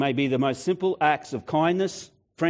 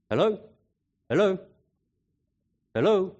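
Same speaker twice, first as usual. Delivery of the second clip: raised, questioning phone hello; calling down the line; 6 semitones higher than usual. Retold or imitated. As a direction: check if anyone is still on the line.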